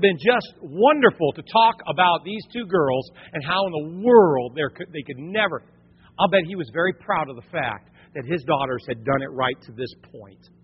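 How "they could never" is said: usual speaking voice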